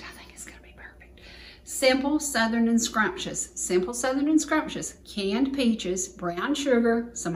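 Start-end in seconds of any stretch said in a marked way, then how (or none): 0.0-1.2 barely audible, quick whisper; faint whisper. Real, not acted. Confiding to the camera in a faint whisper. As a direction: breathe it almost silently.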